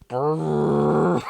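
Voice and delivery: loud sustained laughter; loud laughing fit. Real, not acted. Host set off again by the fic question, loud laughter. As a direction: laugh until it takes over.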